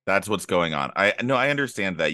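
ordinary talk, nothing marked

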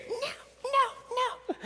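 put-on very high, squealed toddler refusals; imitated hysterical crying. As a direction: mimic a child mid-tantrum. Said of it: "she's crying hysterically".

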